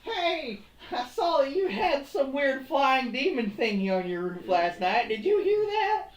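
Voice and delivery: high-pitched mock neighbor voice; imagined neighbor. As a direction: call over to next door in a silly high voice. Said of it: "Can you imagine?".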